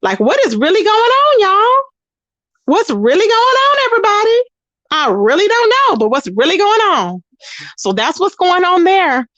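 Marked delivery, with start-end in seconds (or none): 0.0-7.2 baffled disbelief. Retold or imitated. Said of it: "I'm just like".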